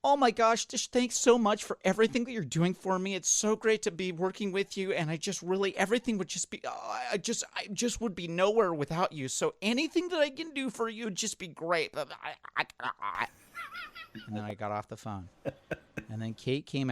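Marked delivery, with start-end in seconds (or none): 0.0-12.0 high, breathless over-eager thanks; talking to his agent, a mock over-grateful gush; gushing sycophant. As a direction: fawn over the agent in a much higher, louder voice. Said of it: "this is how I sounded".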